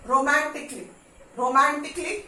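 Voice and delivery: रोमांटिकली said as romantikli, a silent. Shown as a demonstration. Teacher demonstrating silent-a रोमांटिकली to students, clearly. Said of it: "तो इसको pronounce कैसे करते हैं?".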